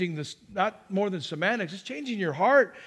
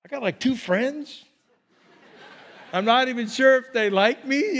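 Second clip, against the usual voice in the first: laughing disbelief; 4 semitones higher than usual. Retold or imitated.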